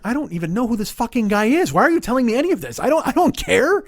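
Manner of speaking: loud, high-pitched mock outburst; exasperated rant. Racer inwardly rants about a chatty stranger, exasperated. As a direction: vent in comic exasperation, pitch climbing.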